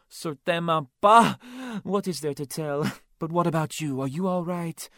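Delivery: flamboyant café owner. Acted. Claude on the phone hearing of the murder, high and dramatic. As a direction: gush over the news, all drama.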